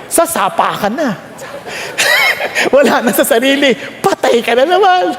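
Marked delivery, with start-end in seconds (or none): 1.4-2.7 a high airy burst of laughter. Real, not acted. Preacher cracking up at his joke, high airy laugh. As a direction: let a squeaky laugh escape.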